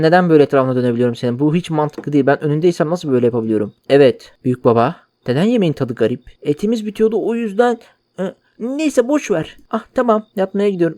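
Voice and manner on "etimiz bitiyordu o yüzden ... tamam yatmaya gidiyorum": hammed-up grandpa voice. Grandpa brushing off his grandson's question, higher and sly. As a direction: play the shifty grandpa covering up, pitched up. Voicing the cannibal grandpa's replies.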